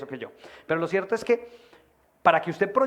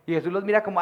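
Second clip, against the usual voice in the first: ordinary talk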